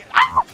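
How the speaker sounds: sudden very high, loud yelp; a sharp scream of surprise; startled yelp. Real, not acted. Spotting Hank in the game, startled yelp.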